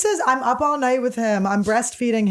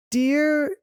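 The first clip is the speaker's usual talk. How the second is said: labored, drawn-out beginner reading; sounding it out; 3 semitones higher than usual. Shown as a demonstration. Showing how they read over a shoulder, sounding it out. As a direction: stretch the word as if struggling to read. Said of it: "you would hear me sounding it out".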